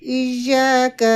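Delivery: یا of ایاک held one alif; slow, drawn ایاک; long ya. Shown as a demonstration. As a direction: stretch ایاک. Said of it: "ایک الف کے برابر لمبا پڑھیں گے".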